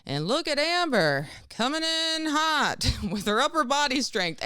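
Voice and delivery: high, loud, mock-cheering announcer voice through laughter; fake-hype the last-place finisher; sarcastic announcer. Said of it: "one of the camp counselors was very sarcastic".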